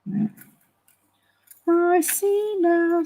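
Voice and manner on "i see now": mock saying. Jokey sung saying.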